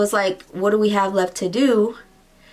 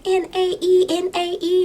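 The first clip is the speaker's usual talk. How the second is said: much higher, careful spelling; higher smitten spelling; high spelled-out name; 10 semitones higher than usual. Retold or imitated. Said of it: "And I was like".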